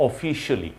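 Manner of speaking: "officially" with a short "shuli" ending; correct pronunciation. Shown as a demonstration. Teacher corrects learners, correct pronunciation. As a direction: say "officially" as "o-FI-shuh-lee". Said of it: "it should be".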